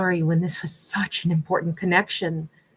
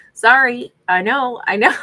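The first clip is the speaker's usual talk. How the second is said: laughing sorry; 5 semitones higher, 5 dB louder than usual. Real, not acted. Laughing apology after sass.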